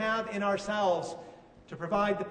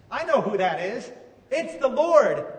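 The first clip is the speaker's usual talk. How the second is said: excited shout; 3 semitones higher, 8 dB louder than usual. Retold or imitated. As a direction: call it out across the water, building to the Lord. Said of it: "John on the boat shouts".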